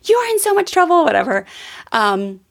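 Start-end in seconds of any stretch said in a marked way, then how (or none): 0.0-1.0 mock scolding in a much higher pitch; high-pitched mock outburst, no real yelling; retold scream. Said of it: "I screamed at him".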